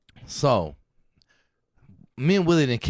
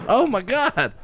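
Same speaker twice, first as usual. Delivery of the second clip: stunned; 10 semitones higher, 4 dB louder, slower than usual. Retold or imitated. Reacting to dad's slap.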